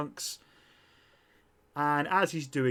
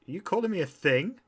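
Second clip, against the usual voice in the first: higher, affronted question; offended; 7 semitones higher, 4 dB louder than usual. Acted. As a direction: take mild offence, pitch lifting. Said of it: "she is offended by that".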